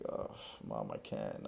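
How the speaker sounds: low, soft retold groan of reluctance; sighing retold excuse to mom; reluctant refusal. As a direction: let your mom down gently, pitch dropped.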